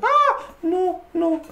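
very high frightened squeal; shriek, then squeak out the refusal; startled scream. Real, not acted. Player meets a scary face, high scared squeal. Jump scare from game figure.

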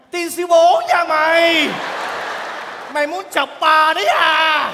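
slow loud furious curse; angry cursing. Acted. Woman yelling at the man who teased her, loud curse. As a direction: snap back in a hammed-up rage.

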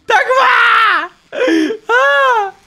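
high, loud, drawn-out begging cry; real desperate shout; desperate plea. Real, not acted. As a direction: beg for your life, stretching it. Begging as the zone kills him.

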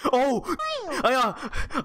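high, loud startled outburst; startled shout. Real, not acted. Player startled by sudden creeper, loud high shout. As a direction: jolt with fright and blurt it out. Creeper appears after asking.

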